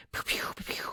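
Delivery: soft unvoiced "pew pew" noises; little whispered zapping effects; imitated lightning zaps. Host retells a sudden storm, mimicking lightning zaps.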